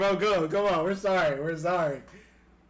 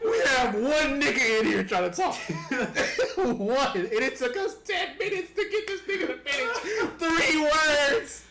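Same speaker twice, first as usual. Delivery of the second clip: high, laughing complaint; 8 semitones higher than usual. Real, not acted. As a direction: complain while cracking up. Laughing rant at friends.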